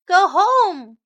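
loud, high-pitched order; chasing a stray away with a loud command; firm go home. Acted. Chorus orders cat home.

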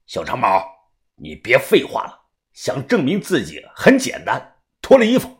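icy, low and restrained; cold. A narrator's reading. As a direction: say it coolly, pitched well down. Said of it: "他冷冷地说道".

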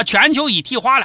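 normal talking voice

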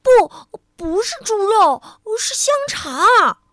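muddled lady loudly insists to the butcher; loud protest. Acted. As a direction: cry out in frustration. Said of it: "糊涂小姐大声说道".

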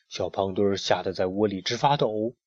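ordinary delivery, nothing marked

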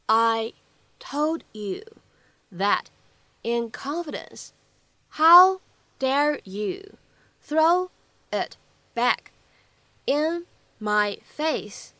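told you, in confidence, how dare you, throw it back in my face, each chunk slow with pauses; slow, word by word. Shown as a demonstration. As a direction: break the sentence into slow chunks for learners. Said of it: "我们需要注意哪些发音技巧".

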